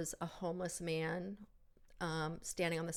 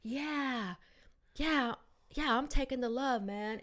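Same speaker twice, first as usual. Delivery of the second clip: quoted homeless man; 6 semitones higher than usual. Retold or imitated. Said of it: "And he was like".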